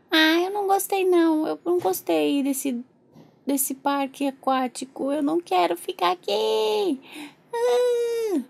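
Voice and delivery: slow high whine building to a wail; whining baby. Pretend. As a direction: complain babyishly, then burst into crying. Pretend baby complains, ends crying. (manha de bebê virando choro)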